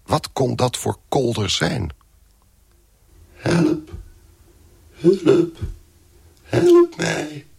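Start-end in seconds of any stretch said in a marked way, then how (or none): 3.3-7.5 high, choked cry that barely gets out; panicked call, breath catching in the throat; strangled cry for help. Acted. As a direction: try to scream for help with no voice. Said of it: "Ik probeerde te schreeuwen".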